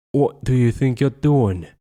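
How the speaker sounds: loud, sharp father yelling; angry shout. A narrator's reading. Dad shouting at his son in the costume. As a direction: shout it at the misbehaving kid. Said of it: "he had shouted".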